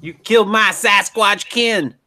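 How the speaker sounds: slow, high creature growl of grievance; Sasquatch voice. Pretend. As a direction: play the wronged Sasquatch. Mock accusation, odd voice.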